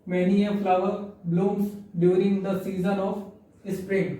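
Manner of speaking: very slow, word by word; slow dictation. Shown as a demonstration. Teacher reading an example sentence to students, slow dictation. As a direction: spell the example out at a crawl. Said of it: "teacher reads example slowly".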